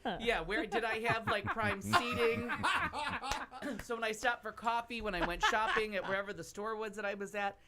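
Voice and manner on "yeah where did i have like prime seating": talking through rising laughter; giggly, amused delivery; laughing. Real, not acted. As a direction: laugh your way through the question. Laughing at her own story.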